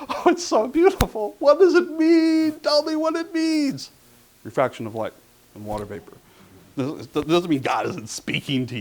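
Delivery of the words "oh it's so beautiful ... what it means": much higher, hammed-up tearful gushing, drawn out; mock weeping awe. Imitating the YouTube guy crying at a double rainbow, high hammed-up awe. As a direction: gush like the man sobbing at a rainbow. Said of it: "and he's crying, and he's going".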